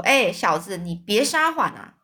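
ordinary delivery, nothing marked

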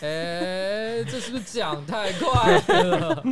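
high, loud, slow laughing question. Real, not acted. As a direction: laugh through the words. Reacting to blunt remark, laughing.

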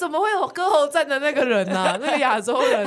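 high, loud laughing disbelief. Real, not acted. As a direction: laugh while blurting it out. Laughing retelling shock.

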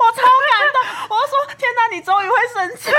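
high, loud, laughing joy; squealing with touched laughter; overjoyed laughing. Real, not acted. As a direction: gush with laughing delight. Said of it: "我很感动".

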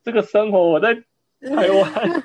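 high, laughing disbelief; wow, it broke my worldview; amazed. Real, not acted. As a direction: marvel at the owner's lifestyle. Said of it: "amazed at the boat lifestyle".

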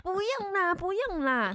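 put-on high, slow easy-going old man; old man brushing off. Old pest man tells her not to rescue him, relaxed old man. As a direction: wave off the worry.

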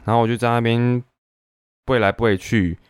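ordinary talk

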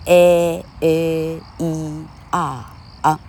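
"A A E R 啊" as slow, separate drawn-out vowels; vowel mnemonic. Shown as a demonstration. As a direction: recite "A A E R 啊" evenly, holding each sound. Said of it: "它的口诀叫".

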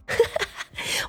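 high, breathy burst of laughing; real laughter. Real, not acted. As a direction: crack up helplessly. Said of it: "我整个笑到不行".

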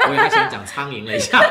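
laughing out loud. Real, not acted. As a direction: burst into laughter. Laughing at kid's answer.

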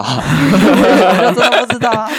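high, loud, slow laughing words; slow laughing protest. Real, not acted. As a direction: say it while laughing hard. Laughing at own story.

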